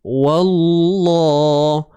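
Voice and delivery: Wallah with a thick, full lam; heavy lam. Shown as a demonstration. Teacher models Wallah with heavy lam. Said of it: "Cuba tuan-tuan sebut".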